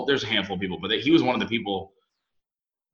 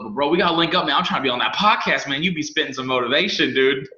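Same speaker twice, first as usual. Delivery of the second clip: higher, louder, laughing a bit; eager friend; 5 semitones higher, 5 dB louder than usual. Retold or imitated. Friend insisting he wants on the podcast, eager and laughing. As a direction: pitch it up, keen and laughing.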